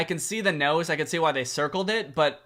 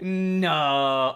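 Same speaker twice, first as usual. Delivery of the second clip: one long, held no; drawn-out mock refusal; 3 dB louder than usual. Vlogger pretending to refuse the top tier, drawn-out mock refusal. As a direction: stretch the word out as if agonizing.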